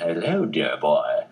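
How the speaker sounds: low, slow, plummy English Noel Coward drawl; lightly mocking impression of an affected Noel Coward type; Noel Coward accent. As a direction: purr it like a Noel Coward dandy. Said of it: "he affected this kind of Noel Coward character accent".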